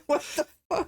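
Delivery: imitated disbelief, pitched high; high, baffled mock question; bewildered mock exclamation. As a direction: play the stunned guy at the bottom of the stairs. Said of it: "just him on the bottom of the stairs going".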